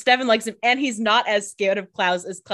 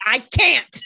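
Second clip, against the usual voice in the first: 1 acted Stefan; 5 semitones higher, 6 dB louder than usual. Stefan refusing Damon.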